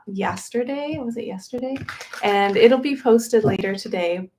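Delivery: normal talking voice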